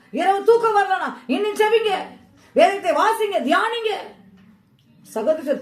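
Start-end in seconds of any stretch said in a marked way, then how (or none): 0.1-3.9 high, loud, driving exhortation; impassioned revival-style cry; full-force preaching. Real, not acted. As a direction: preach it at the top of your range, urging the congregation up.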